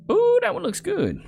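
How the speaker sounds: high, delighted ooh; excited ooh. Real, not acted. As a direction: swoop up with delight. Picking the dog's treat.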